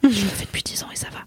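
soft ASMR whisper; right in your ear. Acted. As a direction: breathe the words close to the mic. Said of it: "une partie A S M R dans le podcast".

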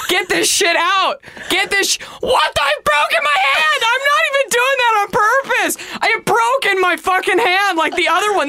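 high-pitched comic shouting; exasperated outburst. Real, not acted. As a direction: cry out in outraged disbelief, pitch way up. Outburst over breaking old makeup.

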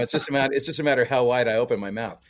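ordinary talk, nothing marked

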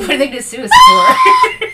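high, loud, laughing yell; laughing shout. Real, not acted. Co-host laughing and shouting at the host's sued joke. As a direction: burst out laughing as you shout it.

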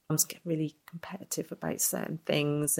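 whispered aside, almost voiceless; a quiet whisper so only the listener hears; confiding whisper. Real, not acted. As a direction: let it slip out as a hushed confidence. Confiding about other mums.

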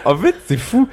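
laughing and squeaking up high; giggling through the words. Real, not acted. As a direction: burst out in amused disbelief. Laughing at accent remark.

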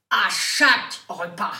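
loud, slow, punching chaque; emphatic repeat. Shown as a demonstration. Host rereading the card, loud stress on chaque. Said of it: "Il y a écrit chaque en majuscule".

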